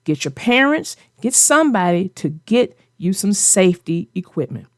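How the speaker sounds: ordinary talk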